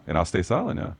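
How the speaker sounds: ordinary delivery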